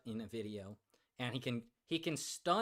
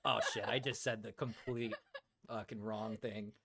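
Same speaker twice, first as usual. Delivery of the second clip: laughing at own slip. Retold or imitated. Streamer retells her slip to chat, laughing. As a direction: laugh while quoting your own blunder. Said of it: "I was like".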